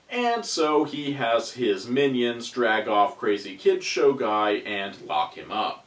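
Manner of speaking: normal talking voice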